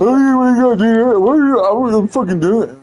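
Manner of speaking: high mocking whine; whiny crybaby. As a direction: imitate a kid whining in protest, high and loud-ish. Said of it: "like a crybaby, man".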